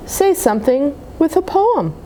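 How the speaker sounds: usual speaking voice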